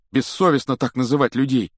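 high, loud indignant outburst; high-pitched, forceful character voice; indignant Ron. Acted. Sick Ron protests the insult.

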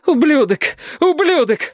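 high shrieking female voice; screaming young woman. A narrator's reading. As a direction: spit the insult at him. Captive woman screams insults.

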